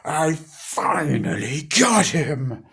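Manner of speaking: high, loud triumphant cry, then slow gloat; gleeful villain. Retold or imitated. Robotnik gloating over Sonic, high and loud then slow. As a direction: crow in victory. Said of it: "he's like".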